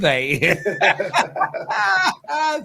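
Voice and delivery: high helpless laugh; burst of laughter. Real, not acted. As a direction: crack up at the accent. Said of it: "sorry, I'm laughing".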